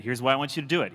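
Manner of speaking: ordinary delivery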